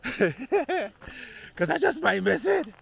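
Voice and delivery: laughing through the words, up high. Real, not acted. Hiker laughing as he races the sunset. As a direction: giggle it out, drawn out slow.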